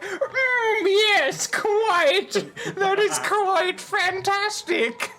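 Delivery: a hammed-up posh woman; posh female voice. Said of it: "I can't do female voices without being like".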